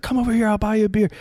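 high, quick retold invitation; imitating an eager beckoning call; calling someone over. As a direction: wave them over with a fast, high call. Retold friendly call across the room.

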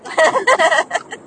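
loud, high burst of giggles; quick rattling laugh. Real, not acted. Laughing at partner who forgets dreams, loud burst.

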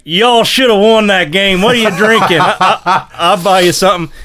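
boisterous mock shout, laughter breaking in; retold rival fan. Rival fan offering a drink after the game, imitated boisterous shout. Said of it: "and the dude's like".

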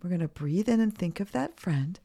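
guided meditation. Acted. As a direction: guide kids calmly through breaths. Leads breathing exercise for kids.

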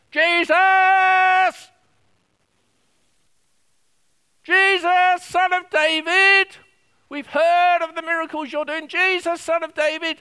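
desperate shout, high and loud; crying out loud. Acted. Blind man shouting to Jesus over the crowd, desperate loud cry. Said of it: "he's crying out".

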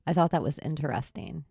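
usual speaking voice

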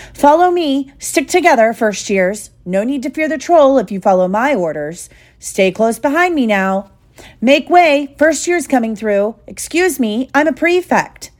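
high, bossy marshalling voice; self-important prefect. Acted. Prefect herding first years away from the troll, self-important. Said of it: "Percy was in his element".

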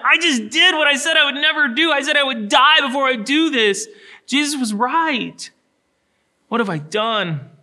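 Peter's despair, shouted high and loud, easing off at the end; anguished cry. Acted. Preacher voicing Peter's heartbroken confession, high anguished shout. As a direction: cry out the guilt, then drop toward the question. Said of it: "the heart hurt that Peter would have felt".

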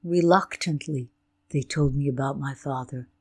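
ordinary talk

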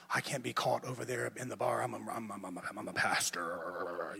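low, hushed and halting, stumbling over I'm; nervous inner worry. Retold or imitated. Young pastor fretting about being seen in the bar, hushed and stammering. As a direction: mutter it to yourself in a panic, slowing down at the end.

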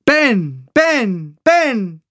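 high, falling echoed calls; echo of a yell. A narrator's reading. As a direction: imitate your shout bouncing back. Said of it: "my voice bounced back at me".

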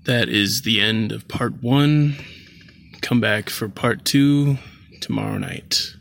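ordinary delivery, nothing marked

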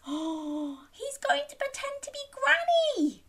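very high, hushed excitement; gasp, then share the secret high; mock shocked. Storyteller letting children in on the trick, high mock shock.

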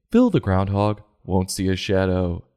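usual speaking voice

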